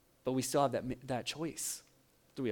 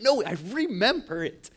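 higher-pitched, mock-indignant retort; insistent protest; 6 semitones higher, 4 dB louder than usual. Husband protesting to his doubting wife, mock insistence. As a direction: playfully insist against a doubter.

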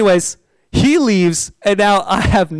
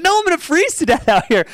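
panicky; 11 semitones higher, faster than usual. Retold or imitated. Stranded in the cold at night.

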